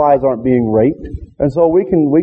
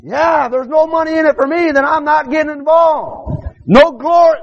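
mock shout; 12 semitones higher than usual. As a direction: bellow the excuse in scorn. Preacher voicing selfish passerby.

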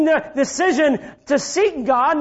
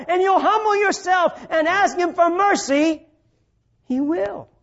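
normal talking voice

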